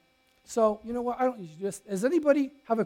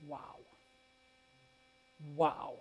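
ordinary talk, nothing marked

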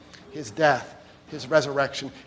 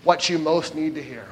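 ordinary talk, nothing marked

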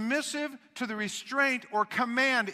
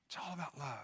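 much softer, near-breathless repeat; hushed echo; 6 semitones lower, 13 dB quieter than usual. Real, not acted. Preacher echoes it hushed for the congregation, soft. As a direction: let the words sink in softly.

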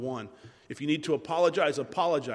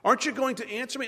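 high, quick, impatient repeat; exasperated calling; 7 semitones higher, faster than usual. Acted. David calling Abner as if for hours, exasperated. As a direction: call out high and fast, fed up waiting. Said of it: "As if he's been calling for hours".

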